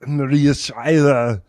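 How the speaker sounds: Schwarzenegger impression. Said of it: "I played Mister Freeze".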